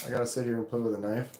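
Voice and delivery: normal talking voice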